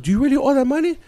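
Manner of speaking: very high mock woman's voice; high-pitched female imitation; accusing wife impression. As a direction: demand an answer as the wife. Wife questions husband.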